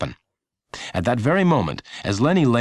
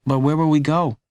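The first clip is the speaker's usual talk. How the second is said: put-on higher boy's voice, worried question; young Lenny; 8 semitones higher, 3 dB louder than usual. A narrator's reading. Lenny asking his mom in the diner, higher boy's voice. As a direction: ask as a worried child.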